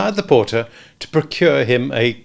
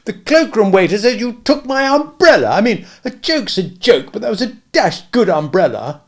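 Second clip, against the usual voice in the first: put-on higher, louder put-upon young man; indignant youth; 10 semitones higher, 5 dB louder than usual. A narrator's reading. Walderwick complaining to Smith, indignant. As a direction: plead your grievance, indignant.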